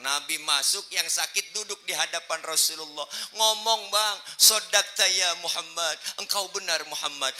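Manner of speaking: high, loud declaiming; impassioned preaching. Acted. Preacher voicing a sick man's confession to the Prophet, impassioned preaching. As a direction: preach it out at full force.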